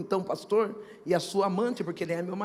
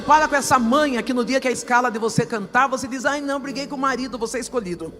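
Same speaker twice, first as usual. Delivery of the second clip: loud, high, fervent preaching, half sung; full preaching; 6 semitones higher, 16 dB louder than usual. Acted. Pastor preaching to the congregation, loud fervent preaching. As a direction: preach it full force to the church.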